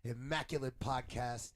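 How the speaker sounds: big ringmaster-style opener, very loud; grand entrance announcement. Real, not acted. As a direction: announce it like a showman hyping the crowd. Podcast host opening announcement.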